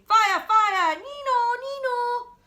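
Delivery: boy playing rescue, shouting siren noises; playful siren call. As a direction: yell like a fire engine. Luther plays rescue, siren.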